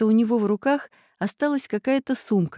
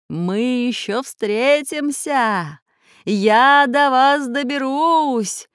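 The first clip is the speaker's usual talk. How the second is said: slow, raised, drawn-out villain call; menacing threat; 6 semitones higher, 3 dB louder, much slower than usual. A narrator's reading. Plant master blown away by storm, calling threats. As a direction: stretch each threat into the wind. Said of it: "Донеслись сквозь ветер последние угрозы".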